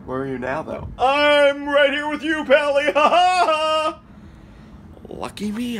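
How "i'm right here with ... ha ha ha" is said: goofy voice. Acted. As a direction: answer in the goofy pal voice. Alien voice answers, laughs.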